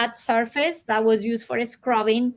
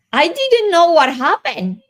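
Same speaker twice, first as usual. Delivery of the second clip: sudden urgency; 4 semitones higher, 6 dB louder than usual. Retold or imitated. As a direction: voice jumps up high and loud. Recalling sudden desperation, louder, higher.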